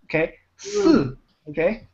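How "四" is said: 四 pitched high, then dropping sharply; falling tone. Shown as a demonstration. Teacher demonstrating the tone to a student, 四 dropping sharply. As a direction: say 四 starting high and falling straight down.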